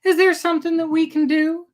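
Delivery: worried eldest daughter. A narrator's reading. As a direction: ask your father gently but urgently. Eldest daughter voiced in story.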